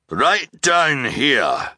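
high, loud, slow call from below; echoey voice. A narrator's reading. Captain calling the orphans down the ladder, echoey. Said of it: "the echoey voice said".

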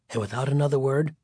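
ordinary delivery, nothing marked

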